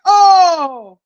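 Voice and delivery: usual speaking voice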